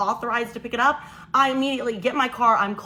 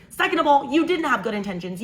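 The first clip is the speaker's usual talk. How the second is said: ordinary talk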